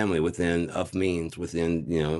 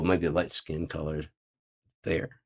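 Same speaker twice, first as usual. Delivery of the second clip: normal talking voice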